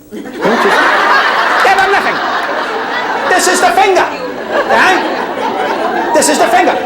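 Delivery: can't get through it without cracking up; laughing. Real, not acted. Laughs through his own joke.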